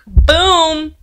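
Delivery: loud punchy exclamation; loud showy boom. Real, not acted. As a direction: punch it like a reveal. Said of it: "sneak peek reveal".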